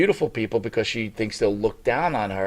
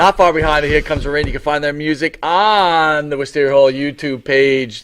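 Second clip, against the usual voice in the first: show announcer read; 4 semitones higher, 5 dB louder than usual. Acted. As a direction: read credits like a host. Reading show plugs.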